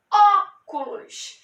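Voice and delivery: "Óculos" drawn out, the opening "ó" punched; slow teaching "Óculos" with heavy first syllable; stressed first syllable. Shown as a demonstration. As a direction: hit and hold the "ó" in "Óculos", then let the rest drop. Repeats word stressing strongest syllable.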